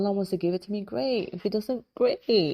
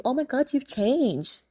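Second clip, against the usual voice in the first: a higher, slow, sing-song "you've changed"; mocking friend; 8 semitones higher, slower than usual. Friend accusing you of changing, mock snide. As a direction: mimic the friend's snide remark. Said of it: "When your friend says to you".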